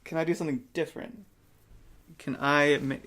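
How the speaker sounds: ordinary talk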